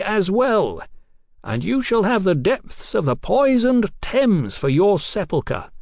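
high, grand declamation; gloating threat. Acted. Captain promises the doctor a watery grave, gloating. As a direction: proclaim triumph and doom across a distance.

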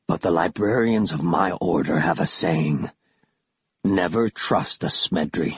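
low, measured villain voice; deep and deliberate, distrustful; menacing creature. A narrator's reading. Killiman quotes his order's saying to Kaz, low menacing creature.

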